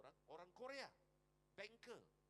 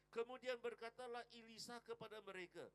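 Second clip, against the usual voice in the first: scripture reading; 7 semitones higher, 9 dB louder than usual. Acted. Reading scripture aloud in sermon.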